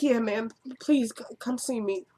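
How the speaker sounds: soft, halting mock-tearful plea; a touch of retold weeping; imitated crying plea. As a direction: stumble quietly over the words as if tearing up. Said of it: "he started crying".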